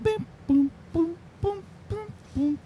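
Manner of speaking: high, soft little bings and booms; high-pitched quiet imitation of game-show effects; mock sound effects. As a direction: fake a soundboard with your voice. Host imitates missing soundboard effects.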